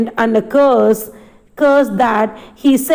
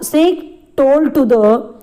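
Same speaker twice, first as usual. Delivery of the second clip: usual speaking voice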